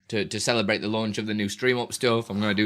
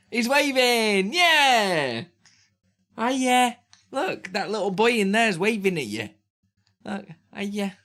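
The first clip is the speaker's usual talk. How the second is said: high sing-song coaxing voice; coaxing his son to wave at viewers; 8 semitones higher, slower than usual. Real, not acted. Talking to waving baby.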